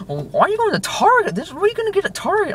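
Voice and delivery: very high mock-outraged disbelief; hammed-up falsetto freak-out; imitating freak-out. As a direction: imitate a friend losing it, pitch shooting up. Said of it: "he's freaking out".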